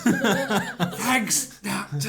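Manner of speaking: high, loud comic thanks through laughter; mock grateful patient. Dead patient to the doctor, high-pitched mock gratitude.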